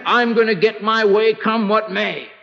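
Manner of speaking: high, stubborn declaration; acted headstrong insistence; self-willed defiance. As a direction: dig in, pitched high. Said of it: "standing the way sometimes we stand".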